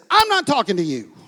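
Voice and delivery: sharp brush-off. Retold or imitated. Pastor retelling his retort to the abusive man, curt and loud. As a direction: snap back at the man, quick. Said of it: "I can't believe I said it like that".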